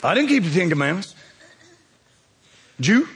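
usual speaking voice

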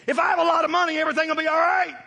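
shouted mock quote of a money-worshipper; preacher shouting a mock creed; mock belief shout. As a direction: bellow the false creed. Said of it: "How many of y'all got this image?".

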